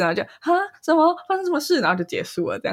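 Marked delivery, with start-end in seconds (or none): 0.4-1.8 high bewildered juror; lost and baffled, pitched up; confused. Retold or imitated. Confused first-time juror.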